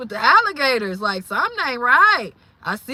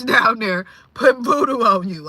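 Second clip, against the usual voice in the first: ordinary talk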